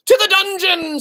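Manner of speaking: a mouse in the front row calling for punishment; mouse in crowd. Acted. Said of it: "said a mouse in the front row".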